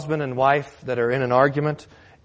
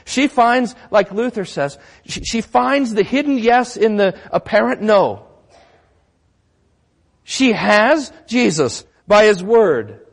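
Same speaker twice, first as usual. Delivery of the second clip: raised, forceful sermon delivery; emphatic preaching; 7 semitones higher, 4 dB louder than usual. Real, not acted. Pastor explaining the woman's faith, emphatic preaching. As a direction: drive it home loudly, slowing at the end. Preacher drives the point home.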